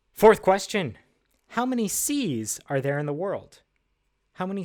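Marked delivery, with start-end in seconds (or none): none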